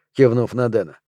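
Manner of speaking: usual speaking voice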